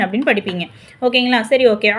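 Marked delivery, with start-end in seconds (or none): none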